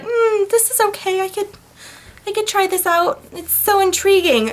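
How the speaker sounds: high, breathy-sweet mock curiosity; dreamy mock Anna. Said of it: "She's like".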